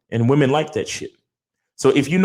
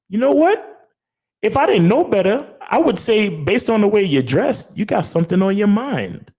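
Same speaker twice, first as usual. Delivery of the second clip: teasing flirtation; 9 semitones higher than usual. Acted. Man flirting with his date about her dress, high and teasing. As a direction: tease it out high, as if sizing up your date.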